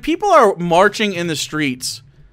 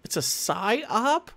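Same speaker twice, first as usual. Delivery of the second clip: drawn-out, rising disbelief; incredulous question; 3 semitones higher, 9 dB quieter, much slower than usual. Real, not acted. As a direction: softly stretch the words into a doubting question. Scoffs at the clip's claim.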